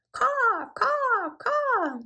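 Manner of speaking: high cawing crow calls; crow caws. A narrator's reading. Crows calling above the rabbit, high cawing. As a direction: caw like crows in the sky.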